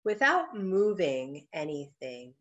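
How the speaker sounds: slow, measured meditation guidance; guided meditation. Acted. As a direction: ease listeners into stillness, phrase by unhurried phrase. Begins leading the guided meditation.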